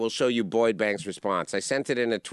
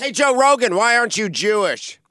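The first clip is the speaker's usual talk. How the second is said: mock call-out; 9 semitones higher, 6 dB louder than usual. Mock calling out Rogan.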